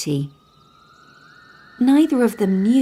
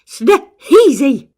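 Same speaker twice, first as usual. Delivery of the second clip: loud, higher dwarf voice; sneezy dwarf; 7 semitones higher, 10 dB louder than usual. Acted. Sneezy announces himself, bursting. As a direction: burst out your name.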